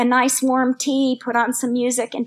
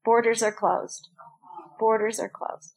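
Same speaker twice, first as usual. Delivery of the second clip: lightly voiced flat corporate notice, a touch lower; mock airline notice; 3 semitones lower, 6 dB quieter than usual. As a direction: read it out like a faceless airline email. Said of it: "Air Canada emails and says".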